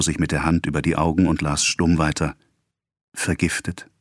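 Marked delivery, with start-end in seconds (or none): 3.1-3.9 stunned. A narrator's reading. Husband reads the wife's letter, stunned. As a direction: let the word barely come out, shocked.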